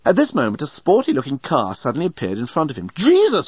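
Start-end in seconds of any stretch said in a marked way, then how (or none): 2.9-3.5 high, loud cry of shock; startled exclamation. A narrator's reading. Driver as a car appears in front of him, exclaiming. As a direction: exclaim it in sudden alarm. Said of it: "he exclaimed".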